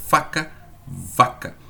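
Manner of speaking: faca, vaca said slowly, f airy, v buzzing; slow contrast demo. Shown as a demonstration. Teacher slowly compares the two words for students. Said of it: "ponto de articulação".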